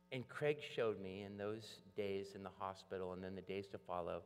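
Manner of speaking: ordinary talk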